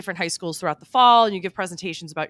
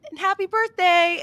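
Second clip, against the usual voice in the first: high, chirpy birthday wish; sing-song it like an amused caller; imitating a cheery well-wisher; imitated amused greeting; 10 semitones higher, 4 dB louder than usual. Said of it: "sound like they were laughing a little bit".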